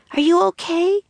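high, rising worried question. A narrator's reading. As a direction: ask anxiously in a high girl's voice. Annie runs to fallen Jack.

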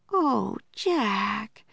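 put-on a touch of girlish sorrow; sad. A narrator's reading. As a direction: say it sadly, sliding down from high. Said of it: "Annie said sadly".

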